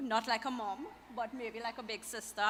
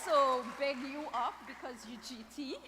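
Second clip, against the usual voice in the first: mock hip slang; 3 semitones higher than usual. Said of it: "You see, I'm getting hip now".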